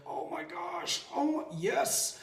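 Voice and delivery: high strained mock groan, rising into yes; high-pitched grudging exclamation; mock exasperated yes. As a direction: act out a reluctant, squeezed yes. Mock struggle, giving in.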